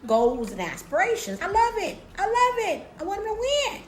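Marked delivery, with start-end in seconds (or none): 1.4-3.8 high, thrilled cheering; mock excited. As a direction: cheer him on playfully.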